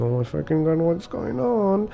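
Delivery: a mild mock freak-out. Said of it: "the model is freaking out".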